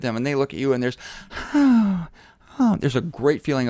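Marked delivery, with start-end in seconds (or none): 1.5-2.1 big breathy sigh into a high, falling "oh"; sigh of love. Acted. Old enemies facing each other, acted sigh of love. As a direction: melt into a falling "oh".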